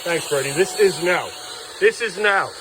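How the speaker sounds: high, emphatic telling-off; frustrated scolding. Real, not acted. Scolding child over the mess.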